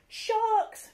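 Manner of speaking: high alarmed cry of sharks; cried out. Acted. Crew member spotting sharks, high frightened cry. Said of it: "cried Rose".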